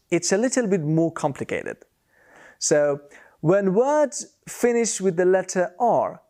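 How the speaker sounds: ordinary talk, nothing marked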